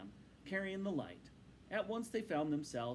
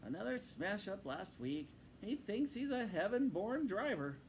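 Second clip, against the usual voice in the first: Rat voice; 8 semitones higher than usual. Acted. Rat complains about Toad.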